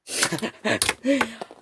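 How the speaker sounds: short high-pitched giggle; self-amused high laugh. Real, not acted. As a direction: giggle in a quick burst. Laughs after showing off.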